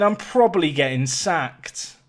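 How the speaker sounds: ordinary talk, nothing marked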